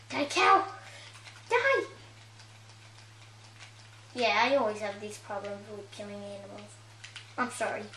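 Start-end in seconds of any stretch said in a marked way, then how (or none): 0.1-1.8 high, loud mock war cry at a cow; playful battle cry. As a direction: yell it while swinging at the cow. Kid attacking Minecraft cow.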